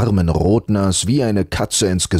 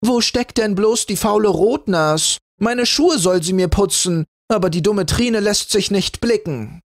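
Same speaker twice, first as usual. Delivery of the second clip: much higher, haughty spoiled sister; calling out; 13 semitones higher than usual. A narrator's reading. Spoiled sister calling for her shoes, haughty. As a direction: demand it from the doorway. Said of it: "Schon in der Tür rief sie".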